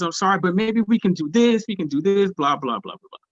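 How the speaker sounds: pleading offers tailing into blah-blah; apologetic scrambling. Retold or imitated. Harried promoter offering excuses.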